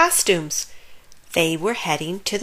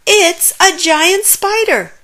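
squeaky fright; 10 semitones higher, 7 dB louder than usual. A narrator's reading. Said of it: "squeaked Pip".

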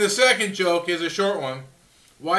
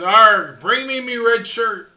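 pirate voice; 3 semitones higher, 7 dB louder than usual. Retold or imitated. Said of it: "the pirate says".

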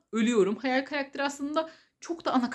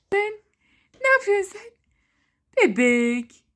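talking to a dog; 9 semitones higher, 8 dB louder than usual. Real, not acted. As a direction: coo at the puppy. Baby talk to her pet. (köpeğe bebek sesiyle)

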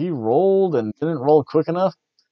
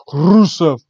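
louder, mock Russian "Rusev"; Russian accent; 3 semitones higher, 7 dB louder than usual. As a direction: snap back into a hammed-up Russian. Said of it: "he tries to start off in Russian".

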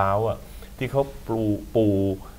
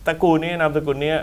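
usual speaking voice

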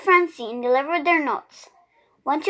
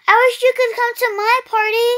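put-on higher, louder boyish plea; wistful kid begging a friend; Arthur's voice; 8 semitones higher, 5 dB louder, faster than usual. A narrator's reading. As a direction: plead like a disappointed kid. Arthur pleading with Francine.

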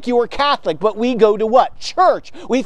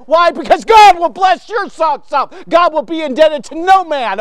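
loud, raised-pitch preaching; passionate yell; 4 semitones higher, 3 dB louder than usual. Real, not acted. Preacher promising listeners God's blessing, in a passionate yell. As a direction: punch it out with fervent conviction. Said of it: "[name], don't yell at me".

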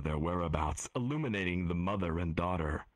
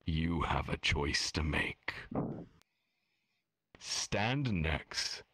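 game master voice; 2 semitones lower than usual. A narrator's reading. GM addresses players.

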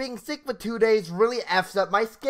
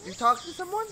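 put-on high, light girlish question; a young girl's curious voice; 11 semitones higher, faster than usual. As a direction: ask innocently, pitched up high.

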